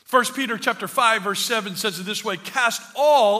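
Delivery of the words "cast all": "all" punched loud; stress on ALL. Real, not acted. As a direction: hit the word "all" hard for the congregation. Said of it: "everybody say all".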